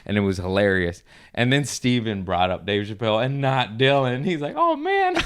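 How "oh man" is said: very high-pitched disappointed impression. Comic imitates host missing his introduction, high-pitched. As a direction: imitate a crestfallen guy in a squeaky voice.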